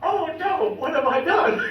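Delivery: high, dismayed cry, half laughing; retold grandpa's dismay; shocked. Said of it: "And he goes".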